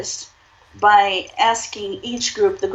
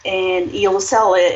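normal talking voice

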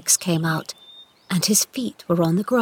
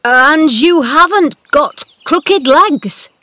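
loud, high, slow wavering question; incredulous, with a wavering voice; shaky voice; 8 semitones higher, 8 dB louder, slower than usual. A narrator's reading. Old gardener questioning the boy, shaky. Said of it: "Ben said in a shaky voice".

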